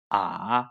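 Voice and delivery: soft A dipping low then rising; A drops down and curls back up; third tone. Shown as a demonstration. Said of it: "A few more times".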